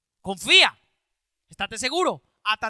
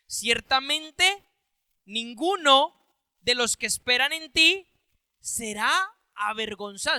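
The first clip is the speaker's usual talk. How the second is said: slow emphatic reading. Shown as a demonstration. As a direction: reread slowly, each word set apart. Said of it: "O sea, mire qué importante".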